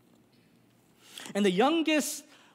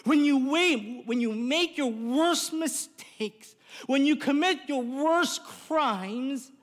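slow emphatic build; 4 semitones higher, slower than usual. Real, not acted.

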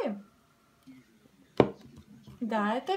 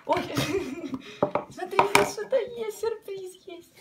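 excited; 7 semitones higher, 3 dB louder than usual. Real, not acted. Surprise found in the box.

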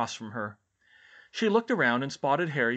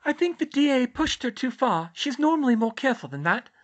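put-on very high, louder girl's voice; Luna's character voice; 13 semitones higher, 7 dB louder than usual. Luna explaining Umbridge's behavior to Hermione, high character voice. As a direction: pitch it high for the odd girl.

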